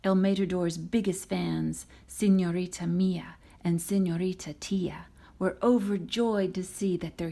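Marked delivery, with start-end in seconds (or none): none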